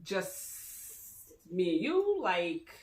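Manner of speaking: very slow, puzzled question; slow imitation of a baffled reply; hesitant disbelief. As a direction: draw it out as it dawns on her. Voices her puzzled reply to invitation.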